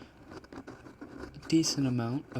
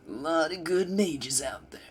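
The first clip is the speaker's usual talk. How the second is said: higher, louder put-on champion voice; Ezreal; 5 semitones higher, 5 dB louder than usual. Streamer doing a requested game voice, high and projected. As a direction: call it out like a cocky game hero. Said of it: "Can you do an Ezreal voice?".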